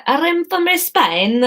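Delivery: put-on high, drawn-out Spanish accent. Reader testing an accent for the courier, shaky Spanish accent. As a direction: try a slow, exaggerated Spanish accent.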